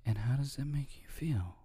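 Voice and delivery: soft, breathy therapist whisper; a therapist whispering to a patient, mock-soothing. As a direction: hush it like a gentle therapist. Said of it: "that's why therapists always".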